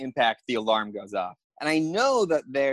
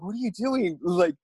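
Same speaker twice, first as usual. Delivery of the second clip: high-pitched exasperated mock yell; retold yell; 7 semitones higher than usual. Thief yells at man hitting car, high mock yell. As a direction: imitate a bewildered man yelling, pitched up. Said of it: "he's yelling at me".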